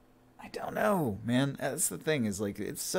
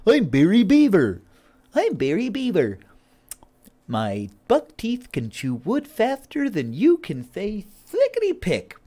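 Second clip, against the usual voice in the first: high, squeaky buck-toothed beaver; cartoon beaver voice; 11 semitones higher, 7 dB louder than usual. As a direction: play a goofy cartoon beaver. Beaver character voice.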